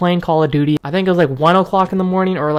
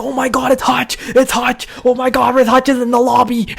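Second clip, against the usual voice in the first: freaking out; 7 semitones higher than usual. Retold or imitated. Fan spotting his idol while mom sleeps, freaking out. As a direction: squeal it high while holding the volume in. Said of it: "But I start freaking".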